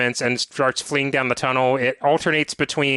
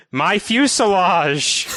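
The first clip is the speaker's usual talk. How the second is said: slow, hammed-up British accent with a mild mock scream, half laughing; Crystal in a put-on British accent; 5 semitones higher, slower than usual. Co-host imitating a teammate's crash line in a mock British accent. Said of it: "she will scream in a heavy put-on British accent".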